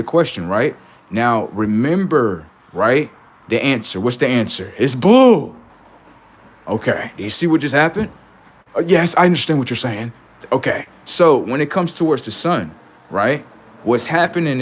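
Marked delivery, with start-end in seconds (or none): 4.8-5.6 higher, louder pupil answer; the imagined student answering the sky question; eager answer. Pretend. 8.7-10.1 higher, quick, polite agreement; the imagined student agreeing with the teacher; polite listener. Pretend.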